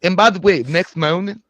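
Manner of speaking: Slender Man voice. Acted. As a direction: ham up the Slender Man greeting like a drunk coach. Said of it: "drunk Barack Obama college football coach".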